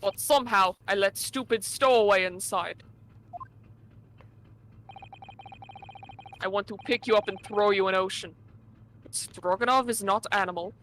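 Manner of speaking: put-on thick Russian accent, dropped articles. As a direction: play a gruff Russian steward. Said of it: "doing a better job with the Russian accent".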